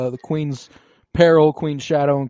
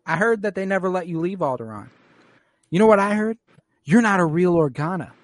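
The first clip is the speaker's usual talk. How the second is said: mocking bratty cousin; 6 semitones higher, faster than usual. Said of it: "Niano's like".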